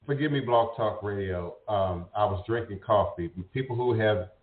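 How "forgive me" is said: ordinary talk, nothing marked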